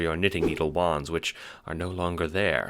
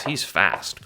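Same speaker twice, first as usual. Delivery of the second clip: plain reading voice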